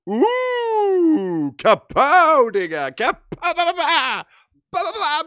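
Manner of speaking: very high, silly shrieked noises; hyped-up shrieks. Real, not acted. Streamer shrieks silly noises about the emote, very high. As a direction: go wild over the emote.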